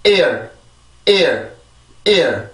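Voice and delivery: "ear" as one falling English syllable; English pronunciation drill. Shown as a demonstration. Teacher reads out the word for learners, drilled "ear". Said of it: "한 번 더".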